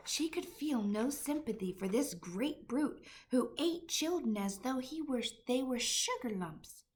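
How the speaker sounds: usual speaking voice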